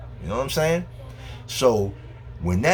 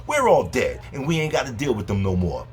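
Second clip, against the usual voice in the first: ordinary delivery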